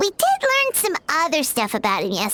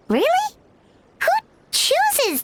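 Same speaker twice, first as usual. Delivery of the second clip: surprised; 11 semitones higher than usual. Acted. Shocked by the address.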